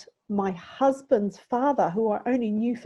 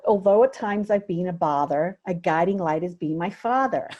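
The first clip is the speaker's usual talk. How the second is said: American accent; 4 dB louder than usual. Shown as a demonstration. Daughter reading Dad's plaque to make the rhyme work, American accent. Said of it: "That's a great accent".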